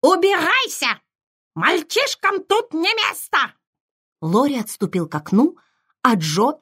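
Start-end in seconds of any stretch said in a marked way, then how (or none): 0.0-3.4 high, shrill parrot squawk. A narrator's reading. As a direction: screech it like a parrot. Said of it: "выкрикнул".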